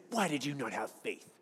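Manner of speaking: low, mocking nagging voice; scolding Jesus. Pastor mimics the way he used to read Jesus, mocking scold. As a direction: sneer it like a condemning scold. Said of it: "I used to read it like Jesus was saying".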